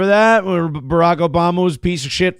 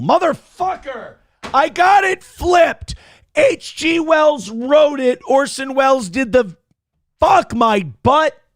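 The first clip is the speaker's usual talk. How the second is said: frustrated outburst; 7 semitones higher, slower than usual. Real, not acted. Caught mixing up the Wells names on air, exasperated yelling. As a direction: yell at yourself for flipping the facts. Realizes he got the facts flipped.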